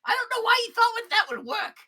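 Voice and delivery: high, loud smug voice; gloating shopkeeper. Acted. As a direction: gloat over the corpse. Gleb gloats over dead man.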